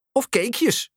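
put-on eager, high-pitched child's call. A narrator's reading. Leroy to his mum at the market, calling out. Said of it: "roept Leroy".

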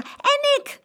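very high, loud shout; calling out. A narrator's reading. Boy chiming in after his sister, high shout. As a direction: pipe up with a shouted reply. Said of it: "riep Jeroen".

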